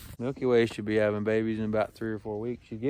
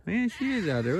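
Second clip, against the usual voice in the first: ordinary talk, nothing marked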